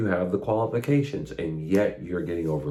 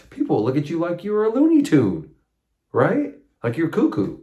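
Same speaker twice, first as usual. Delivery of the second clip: higher and louder, mocking how people will see you; mock incredulous; 9 semitones higher, 5 dB louder than usual. Real, not acted. Preacher to congregation, higher and louder in mock disbelief. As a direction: paint them as calling you cuckoo.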